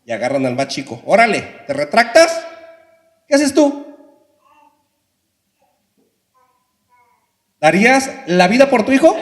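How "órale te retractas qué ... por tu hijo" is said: loud, high mocking challenge; taunting soldier. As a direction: jeer at the father to break him.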